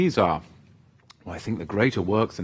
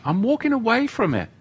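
higher, fast, playful sing-song; sing-song reply; 11 semitones higher, faster than usual. Acted. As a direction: toss it off high and quick, teasing. Answers his own advice lightly.

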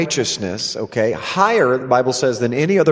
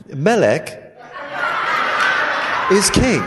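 laughing mock British accent, king pitched higher; hammed-up British accent on request; 5 semitones higher than usual. Shown as a demonstration. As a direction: put on a posh-sounding British voice, laughing. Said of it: "do it in a British accent, then".

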